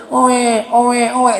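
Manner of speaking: put-on baby wailing; imitated baby cry. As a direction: mimic a newborn's "oe, oe" crying. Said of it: "Kita dengar oe".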